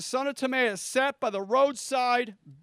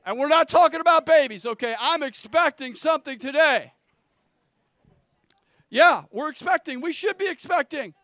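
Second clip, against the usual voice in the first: preaching; 3 semitones higher, 5 dB louder than usual. Acted. Pastor firing up the congregation, loud and rousing. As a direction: proclaim it to the rafters.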